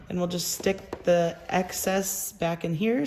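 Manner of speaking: ordinary talk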